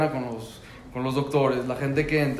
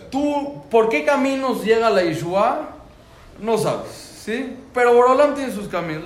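fervent declaration; 8 semitones higher, 7 dB louder than usual. Real, not acted. Emphatic faith declaration.

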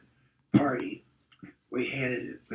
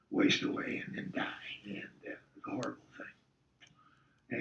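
hushed grief; 4 semitones lower, 7 dB quieter than usual. Real, not acted. Recalling coach's death, voice sinks.

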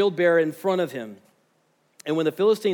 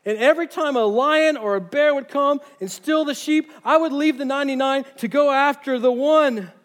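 David urging Saul; 7 semitones higher, 3 dB louder than usual. Acted. David urging Saul before Goliath.

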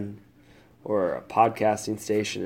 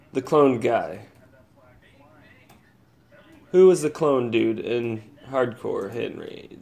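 usual speaking voice

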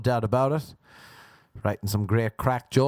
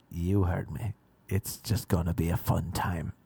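put-on low, slightly softened older-man voice; coaxing older man; 8 semitones lower, 4 dB quieter than usual. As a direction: insist gently, keeping it low. Old man insists on his proposition.

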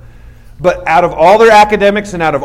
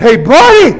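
shouted challenge across the store; loud confrontational hey buddy; loud call-out; 8 semitones higher, 9 dB louder than usual. Retold or imitated. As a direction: call him out loudly. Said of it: "I went".

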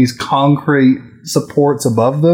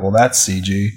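dry deadpan; 6 semitones lower, 4 dB quieter than usual. Retold or imitated. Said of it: "I was like".

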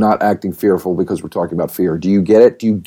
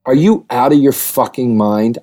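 ordinary delivery

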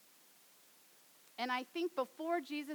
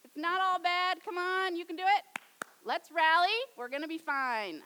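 loud, high hearty coach voice; mock rally speech, much higher and louder; pep talk mockery; 7 semitones higher, 10 dB louder than usual. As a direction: bluster like a cheerleader. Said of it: "this is Jesus going".

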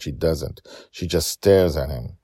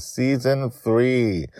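slow, stretched season title; drawn-out announcement; 8 semitones higher, much slower than usual. Acted. Host announcing the new season slowly. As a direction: savor each word like a trailer.

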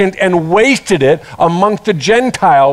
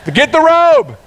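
higher, urgent orders to servants; calling out; 6 semitones higher than usual. Retold or imitated. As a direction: bark orders for robe and sandals. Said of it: "he yelled back".

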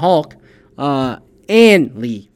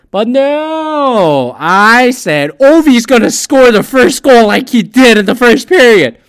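excited; 9 semitones higher, 10 dB louder, slower than usual. Retold or imitated. Retelling his emotional bet.

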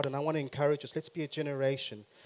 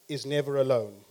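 ordinary delivery

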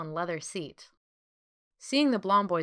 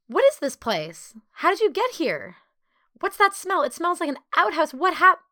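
high, loud string of questions; pitched way up, loud and insistent; agitated questioning; 10 semitones higher, 7 dB louder than usual. Acted. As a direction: fire off questions until cut short. Barrage of questions, cut off.

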